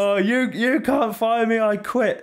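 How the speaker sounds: high, hammed-up indignant whine; mock petulant quitter. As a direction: play a sore loser storming off. Joking as the beaten villain quitting.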